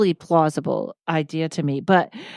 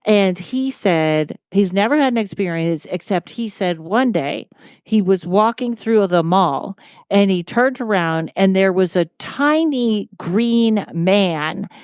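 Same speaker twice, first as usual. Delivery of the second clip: ordinary talk, nothing marked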